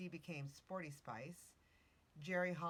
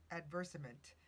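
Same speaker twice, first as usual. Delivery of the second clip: ordinary talk, nothing marked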